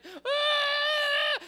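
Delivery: very high, loud mock scream; imitated kids' scream. First graders running from gorilla, shrieking. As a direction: shriek like panicking children. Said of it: "they're screaming".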